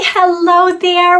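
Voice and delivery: plain reading voice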